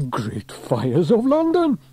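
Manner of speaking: high, drawn-out exclamation; astonished exclamation. A narrator's reading. Sam spotting the lost envelope, exclaiming in surprise. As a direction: exclaim it in amazement. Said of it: "he exclaimed".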